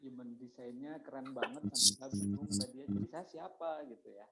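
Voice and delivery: very faint, far from the mic; barely audible. Real, not acted. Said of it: "volume suaranya agak terlalu kecil".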